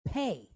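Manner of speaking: Pe starting with a popped P, "pay"; p sound. Shown as a demonstration. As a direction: open Pe on a hard P. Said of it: "it sounds like a P".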